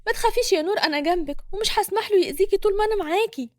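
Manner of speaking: high-pitched anxious reassurance; worried for her friend. A narrator's reading. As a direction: comfort her, concern raising the pitch. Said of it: "حنين بخوف على صاحبتها". (بخوف على صاحبتها)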